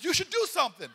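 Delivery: loud mock-profound wisdom; triumphant proclamation. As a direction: deliver it like a revelation. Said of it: "She goes".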